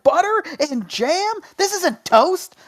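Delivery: slow, high-pitched incredulous protest; mock-outraged, stretch each word in disbelief; losing it. A kid offered butter for pancakes, retold outrage. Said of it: "I was, like, losing my mind".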